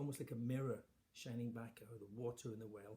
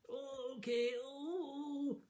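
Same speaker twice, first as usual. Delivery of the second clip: much higher, wailing mock-weak voice; fake feeble sigh; 4 dB louder, much slower than usual. Queen still faking sickness to the king, high wail. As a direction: keep up the act as he leaves. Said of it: "she was only pretending".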